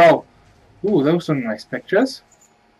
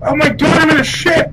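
high excited yell; excited shout; 12 semitones higher, 6 dB louder than usual. Real, not acted. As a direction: burst out thrilled. Excited at getting a ship.

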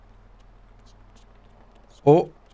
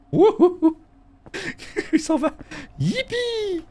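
squealed high yippee with a laugh; high cheer; slower than usual. Real, not acted. Gamer cheers as the ogre leaves, squealed high. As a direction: squeal it out, overjoyed.

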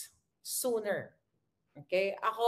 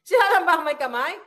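laughing defiance; 4 semitones higher, 8 dB louder than usual. Real, not acted. Repeats it laughing, louder.

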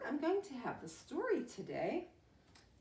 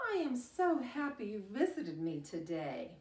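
plain reading voice

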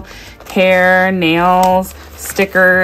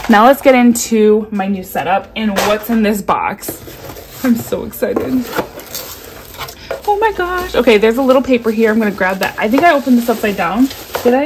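normal talking voice

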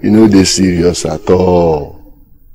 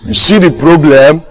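louder, high-pitched demand; raised voice, rising at the end; insistent question; 9 semitones higher, 5 dB louder than usual. Acted. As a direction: press the question home, high and loud. Repeats the question more forcefully.

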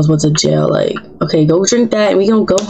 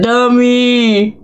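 "Dummy" sung out higher in mockery; higher mocking taunt; 4 semitones higher than usual. Mocking a Sim, second taunt.

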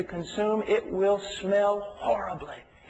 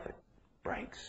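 whispered, much softer; a hushed, breaking word; 15 dB quieter than usual. Acted. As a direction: drop it to a bare whisper. Dramatic whispered word in sermon.

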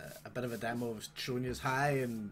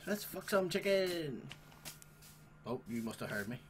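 usual speaking voice

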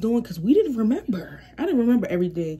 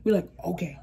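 lower, subdued mock compliance; acting funny; 2 semitones lower, 6 dB quieter than usual. Sisters answering the brother's orders, acting funny, subdued. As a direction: play it low and odd, agreeing obediently. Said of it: "acting funny".

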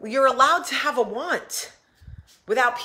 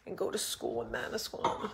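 tearful; 3 semitones lower, 12 dB quieter than usual. Real, not acted. Voice breaking, nearly whispered.

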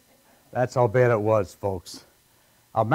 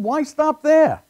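excited shout; 12 semitones higher, 8 dB louder, slower than usual. Retold or imitated. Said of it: "I said".

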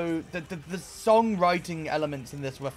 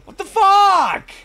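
higher and louder each time, drawn out; escalating shocked shout; 13 semitones higher, 12 dB louder, slower than usual. Real, not acted. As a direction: explode in disbelief. Reacting to the album's ending.

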